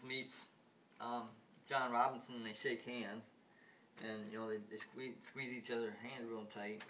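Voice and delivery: ordinary talk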